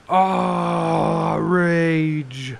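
hammed-up roar of an enraged player; mock rage. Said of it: "That's the face of a Monarch player".

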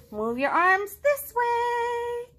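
high, slow, sing-song instruction; teaching Piggy. Acted. Piggy slowly shows Gerald the arm move. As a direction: draw out each word like a dance teacher.

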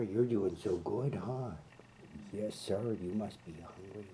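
ordinary talk, nothing marked

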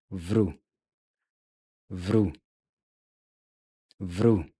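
"vru" with a V, a tapped R and a U; isolated syllable. Shown as a demonstration. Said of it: "Adding in the V, we get". (the tapped "vru" syllable, very clear)

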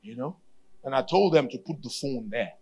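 ordinary talk, nothing marked

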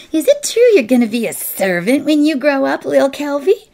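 put-on shrill, fast, high taunting girl; shrill it out loudly in a rush; high, loud jeering child. A narrator's reading.

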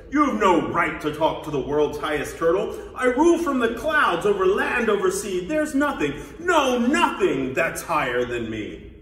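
loud, haughty royal tirade; howling. Acted. Turtle king rules from the clouds, howling. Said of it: "howled the mighty King Yertle".

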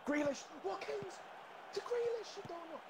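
rising, high-pitched calls of names; excited shouting. Real, not acted. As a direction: cheer each name as the move builds. Cheering an attacking move.

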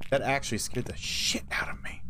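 fast, low whisper. Real, not acted. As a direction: breathe it out in shock. Whispered after fright.

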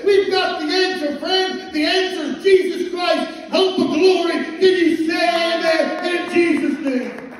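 rising, loud pulpit preaching with a call for amen; fiery preaching. Acted. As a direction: preach it at full force to the church. Preaching, congregation answers.

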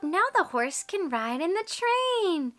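usual speaking voice

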